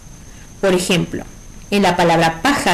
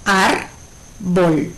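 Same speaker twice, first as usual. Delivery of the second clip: ÁRBOL split into ár, bol; Árbol with a clear pause between Ár and bol; syllable by syllable. Shown as a demonstration. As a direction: say Árbol slowly as two separate syllables. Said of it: "es una palabra de dos sílabas".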